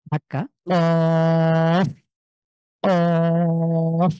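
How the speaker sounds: কফ with a hard push of the back of the tongue on the palate; pushed qaf. Shown as a demonstration. Teacher demonstrating a pushed কফ for students. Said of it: "ধাক্কা দিয়ে".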